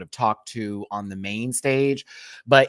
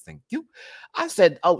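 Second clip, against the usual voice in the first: normal talking voice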